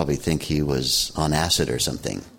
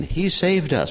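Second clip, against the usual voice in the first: higher, hammed-up cry of praise; a retold passenger's shout, playful and much higher; mock triumphant shout; 10 semitones higher than usual. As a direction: call it out to the whole plane like a hero was found. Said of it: "he shouted to the whole plane".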